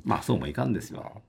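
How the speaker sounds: coaxing, hushed reminder of duty; gently admonishing. Real, not acted. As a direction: reason with them quietly, as if to make them accept it. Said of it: "その言い聞かせるような言い方".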